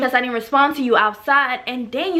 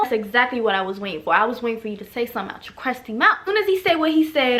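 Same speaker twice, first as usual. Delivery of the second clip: ordinary talk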